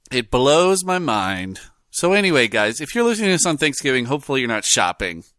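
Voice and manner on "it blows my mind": slow. Real, not acted.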